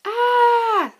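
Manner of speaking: high, loud frightened scream; terrified shriek. Acted. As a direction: shriek at the sight of the shark. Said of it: "Stanley shrieked".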